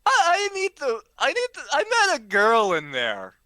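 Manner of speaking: put-on high, loud drunken pleading; desperate drunk wailing about a girl; drunk lovestruck plea.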